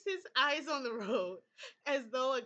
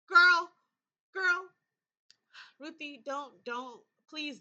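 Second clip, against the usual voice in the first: much louder, higher exasperated call; exasperated yell; 4 semitones higher, 12 dB louder, slower than usual. Real, not acted. Player yells at Ruthie in exasperation, much louder. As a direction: stop her right there.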